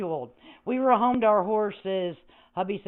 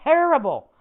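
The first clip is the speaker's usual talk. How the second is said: louder, higher cry of "Terrible"; exasperated exclaim; 6 semitones higher, 7 dB louder than usual. Real, not acted. About bad audio to chat, a loud exasperated exclaim. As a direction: blurt out "Terrible" in dismay. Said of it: "It sounded terrible".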